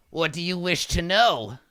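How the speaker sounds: imperious kobold king. Acted. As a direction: question a lowly petitioner grandly. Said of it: "looks at it and he says".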